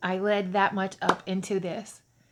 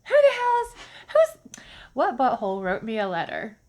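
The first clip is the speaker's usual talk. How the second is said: much higher, louder puzzled outburst; mock-outraged wondering; incredulous; 3 semitones higher, 6 dB louder than usual. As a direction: squeal in comic disbelief. Incredulous thought voiced aloud.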